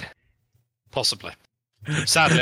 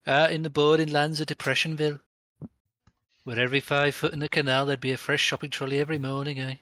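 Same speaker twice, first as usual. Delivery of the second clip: put-on Birmingham accent; 3 semitones higher than usual. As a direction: lay on a jokey Brummie twang. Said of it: "a stereotype of the Birmingham accent".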